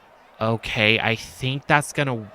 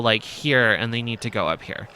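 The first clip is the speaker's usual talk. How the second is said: ordinary delivery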